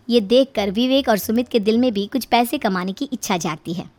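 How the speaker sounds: usual speaking voice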